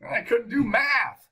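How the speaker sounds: high, loud self-mocking squeal; mock fired-up. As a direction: parody your own giddy triumph, pitch way up. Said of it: "Got all fired up".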